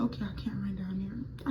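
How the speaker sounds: hushed, breathless whisper of panic; frightened unvoiced whisper; scared whisper. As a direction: whisper it like someone might hear. Panicked self quote at road.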